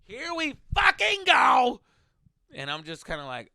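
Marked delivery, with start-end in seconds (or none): 0.0-1.8 slow, high, loud hammed-up yell; drawn-out mock cheer; mock hype shout. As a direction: belt it like a rowdy fan. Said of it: "I'm like".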